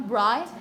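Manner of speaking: loud, lifted "bright", cut off as the stressed syllable; stressed syllable alone. Shown as a demonstration. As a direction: hit "bright" hard and leave it hanging. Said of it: "the stressed syllable, the first one".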